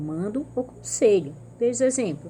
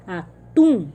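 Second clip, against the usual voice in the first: atum with TUM punched much louder; última sílaba forte; 8 dB louder than usual. Shown as a demonstration. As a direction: hit the final TUM of atum hard. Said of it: "o tum é mais forte". (atum, com o TUM bem forte)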